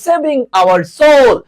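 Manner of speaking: sing-song it slowly to drive it home. Shown as a demonstration. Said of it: "এস ও এস".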